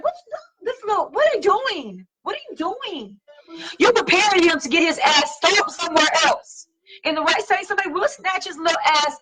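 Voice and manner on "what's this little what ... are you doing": higher, baffled questioning; incredulous mock. Speaker watching a parent spoil a screaming kid, higher and incredulous. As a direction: act stunned at what you see. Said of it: "I'm like".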